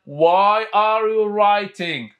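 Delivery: very slow, stretched phrase; slow model reading. Shown as a demonstration. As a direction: drag the sentence out for repetition. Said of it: "خلينا نعيدها مرة ثانية".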